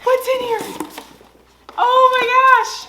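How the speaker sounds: very high squealy excitement; excited pet talk. Real, not acted. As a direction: gush at the dogs as the box opens. Opening box for the dogs.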